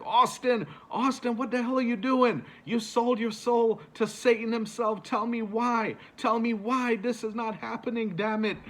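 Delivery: furious disbelieving announcer scream; frantic commentator outburst. Retold or imitated. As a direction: lose your cool on the mic, begging him why. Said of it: "J R was just flipping out on the commentary".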